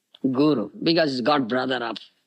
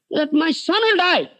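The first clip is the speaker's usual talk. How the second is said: high, mock-tearful wail; tearful complaint; 12 semitones higher than usual. Crying wife tells Shivananda of the curse, plaintive high wail. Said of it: "his wife was crying".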